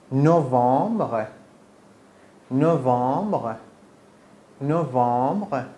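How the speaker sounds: نوامبر drawn out slowly with a French uvular r; slow, clear نوامبر for the student to copy; slow French pronunciation. Shown as a demonstration. Teacher slowly says نوامبر, asking the student to repeat after her. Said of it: "تکرار کن بعد منو".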